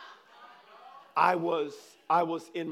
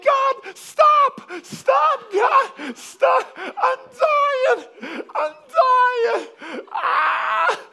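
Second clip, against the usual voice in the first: very high, strained, shouted pleading; dying laughing, imitated; 15 dB louder, much slower than usual. Man begging God to stop the laughter, high mock-desperate shouting. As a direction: scream for mercy through mock helpless laughter. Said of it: "dying laughing".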